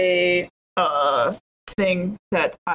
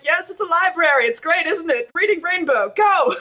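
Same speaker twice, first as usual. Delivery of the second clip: much higher, fast, bright fake enthusiasm; forced cheer; 7 semitones higher than usual. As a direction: hype it up like a kids' show host, then launch. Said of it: "be like".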